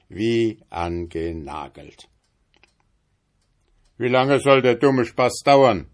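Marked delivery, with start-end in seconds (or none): 4.0-5.9 loud, higher grumpy devil's complaint; very bad temper. Stuck devil complains to the journeyman, loud and cross. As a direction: grumble it out, fed up and cross. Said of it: "in außerordentlicher Übellaune".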